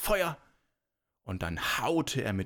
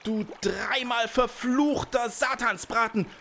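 high, raised cursing; furious shout; 11 semitones higher, 4 dB louder than usual. A narrator's reading. Giant curses the girl who got away, furious shout. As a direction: hurl the curse at full pitch. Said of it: "rief der Riese".

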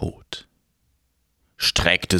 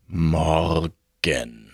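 grim reaper voice; 5 semitones lower than usual. Acted. Death writes, low.

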